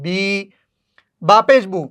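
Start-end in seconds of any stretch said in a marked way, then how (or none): 0.1-0.2 বি with its long ee held; long vowel. Shown as a demonstration. Teacher showing how khara zer is read, lengthened বি. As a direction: draw out the vowel in বি. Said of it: "একটু দীর্ঘস্বরে পড়ি".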